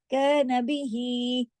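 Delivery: very slow chant of कैन बिही; Quran recitation. Shown as a demonstration. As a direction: stretch कैन for two counts. Recites phrase.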